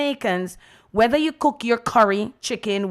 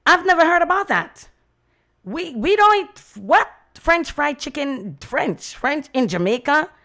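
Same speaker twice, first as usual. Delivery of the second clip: incredulous; 6 semitones higher than usual. Retold or imitated. Retelling her Jamaican friend's scoffing disbelief, high and incredulous. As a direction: play the scoffing friend, higher.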